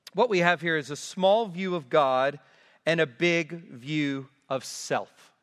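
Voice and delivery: normal talking voice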